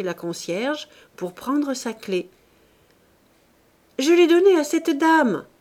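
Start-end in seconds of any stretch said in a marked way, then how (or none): 4.0-5.5 crié beaucoup plus haut et plus fort; crié de loin. Acted. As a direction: appeler du fond de la loge. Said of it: "cria madame Joseph du fond de la loge". (la concierge crie du fond de la loge, haut et fort)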